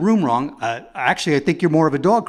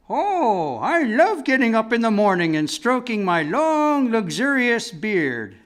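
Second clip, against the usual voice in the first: higher, smug, slowing on luxurious; lying bald man; 7 semitones higher than usual. Pretend. Comedian playing someone in a bald-faced lie, higher smug brag. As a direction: purr the brag like an obvious lie. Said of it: "caught in a bald-faced lie".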